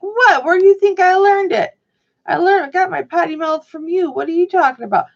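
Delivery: high, loud indignant protest; mock indignant. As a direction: protest like an outraged daughter. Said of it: "And I'm like".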